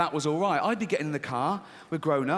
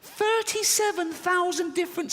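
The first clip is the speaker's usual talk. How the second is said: much higher incredulous repeat; high-pitched; 14 semitones higher than usual. Shown as a demonstration. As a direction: say it again way up high. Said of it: "repeated higher".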